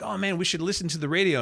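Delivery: high, fast, eager pitch to friends; excited pitch. Retold or imitated. Boy urging friends to hear his tape, high and excited. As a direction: talk them into listening, excited and high. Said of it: "I'm like".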